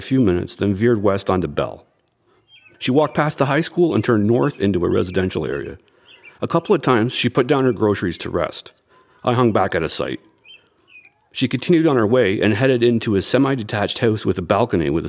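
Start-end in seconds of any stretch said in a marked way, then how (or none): none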